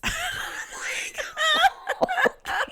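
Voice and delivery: squealing burst of laughter; high cackle of glee; high shrieking laugh. Real, not acted. Laughing at dad's reaction.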